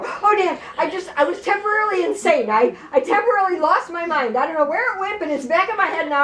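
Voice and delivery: imitating a high-pitched, caught-out plea; panicked excuse. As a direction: backpedal frantically to an angry father. Panicked excuse to father, retold.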